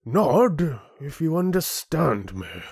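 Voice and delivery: slow, high-pitched condescension; condescending chieftain. Acted. Chieftain ordering land dweller to obey, condescending. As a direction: spell it out slowly to an underling.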